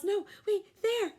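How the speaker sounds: high, loud correction; Ruby yelling to the herd. A narrator's reading. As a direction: shout the new spot. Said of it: "Ruby yells".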